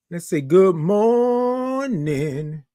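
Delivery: drawn-out morning show greeting; stretched good morning. Shown as a demonstration.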